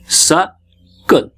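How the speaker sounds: "सक्कल" slowly split as suh, kul; two syllables apart. Shown as a demonstration. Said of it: "टू syllable". ("सक्कल" दो हिस्सों में, रुककर)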